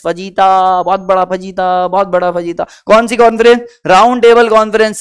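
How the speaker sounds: normal talking voice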